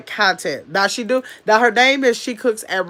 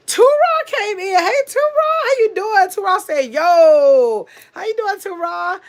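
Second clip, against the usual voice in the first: excited greeting; 10 semitones higher, 4 dB louder than usual. Real, not acted. Streamer hailing a viewer who came in, excited high greeting. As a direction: call out to a friend who just walked in.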